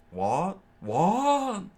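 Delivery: loud, rising to a high shriek; horrified scream. A narrator's reading. Patty on the floor, horrified, high loud What. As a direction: yell it twice in horror. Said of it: "Patty screamed out".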